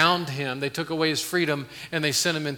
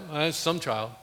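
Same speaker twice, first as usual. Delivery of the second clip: unbothered, even dad voice; offhand dad. Retold or imitated. Dad hearing a child cry in a crowd, offhand and even. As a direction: shrug it off like a dad who doesn't notice. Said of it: "Dads are like".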